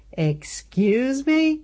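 high, slow, barely holding steady; fighting to keep the voice from shaking; shaky disbelief. Acted. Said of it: "keep it from shaking".

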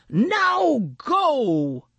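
high, forceful order; Maxwell commanding. A narrator's reading. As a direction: drive the bully off sharply. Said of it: "Maxwell said, pointing a wing".

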